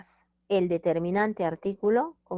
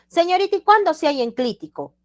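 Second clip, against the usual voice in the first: high, loud pupil voice; acted student question; 10 semitones higher, 7 dB louder than usual. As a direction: call out to the teacher as an eager student, pitched way up. Voices student asking the teacher.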